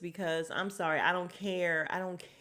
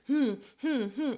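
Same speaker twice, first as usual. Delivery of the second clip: mock skeptical hum; 4 semitones higher, 4 dB louder than usual. [name]'s sceptical humming.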